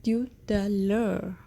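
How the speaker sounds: "Tout à l'heure" said slowly with weight on "tout"; teacher demo of "Tout à l'heure"; slow model phrase. Shown as a demonstration. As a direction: draw out "Tout à l'heure" for the class. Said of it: "In French, the word heure is pronounced".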